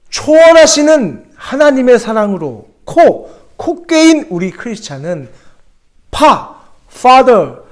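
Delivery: high, loud, slow call on 초월하시는; loud repeat. Shown as a demonstration. Pastor repeats the acrostic on request, loud call. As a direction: cry the acrostic out again with force. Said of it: "다시 한번요".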